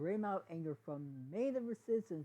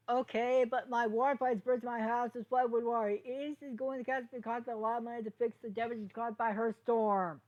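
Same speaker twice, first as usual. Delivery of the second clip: loud, higher crowd shout; yelling; 7 semitones higher, 7 dB louder than usual. Acted. Angry pony yells from the crowd about burst pipes. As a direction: call out angrily over the crowd. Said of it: "A point in the crowd yelled".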